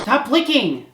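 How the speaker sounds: high, loud yell at the cat; sharp real shout at a licking cat; scolding shout. Real, not acted. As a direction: snap at the pet to stop. Scolding cat mid-video.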